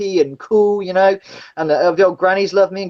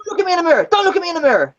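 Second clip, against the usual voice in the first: high, sharp mock yell; imitated furious yelling; 8 semitones higher, much faster than usual. Driver imitating the passenger screaming at him, high mock yell. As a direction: bark it out like the raging passenger. Retelling Rambo's rage at driver.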